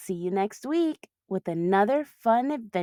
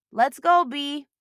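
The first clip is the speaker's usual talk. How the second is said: higher, louder call; 7 semitones higher, 5 dB louder, slower than usual. Acted. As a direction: call her daughter over. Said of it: "Mom shouted".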